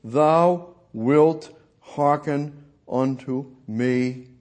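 very slow, deliberate scripture reading; measured, drawn-out verse with spaces between words. Shown as a demonstration. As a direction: read it slowly, word by word. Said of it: "I'm gonna read it slow".

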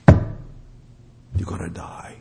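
slow, low near-whisper; whispered warning, word by word; hushed warning. Real, not acted. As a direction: drop to a grave, drawn-out whisper. Preacher repeats grave warning, hushed.

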